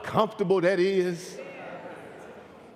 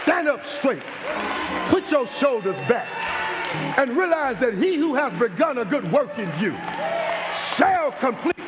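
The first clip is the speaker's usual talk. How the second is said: full force preaching; 5 semitones higher than usual. Acted. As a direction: lift the congregation, build and drive it home.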